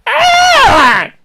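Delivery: loud, high startled cry; sudden yelp. Real, not acted. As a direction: let out a sharp high yelp. Sudden outburst before technical difficulties.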